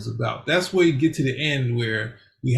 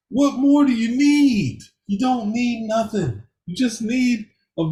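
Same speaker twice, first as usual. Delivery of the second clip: exasperated rant; 8 semitones higher than usual. Real, not acted. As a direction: demand it, fed up with the fuss. Ranting about villain motives.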